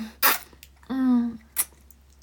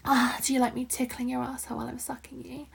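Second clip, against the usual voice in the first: usual speaking voice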